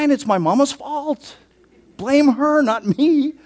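playful blame. Real, not acted. Jokingly blaming his mother.

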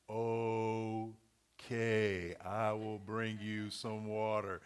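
put-on slow, drawn-out childlike reply; mock slow-motion child; slow kid voice. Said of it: "give you an example".

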